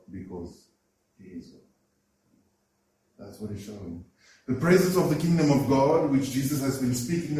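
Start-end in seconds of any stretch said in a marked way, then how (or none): 0.1-4.0 hushed. Real, not acted. Revelation whispered in sermon.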